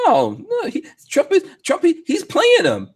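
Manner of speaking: high-pitched mocking imitation of believers; mock Trump defenders. Host mimics supporters defending Trump's strategy, high mocking voice. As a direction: pitch it way up, parodying their excuses. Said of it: "everyone's like".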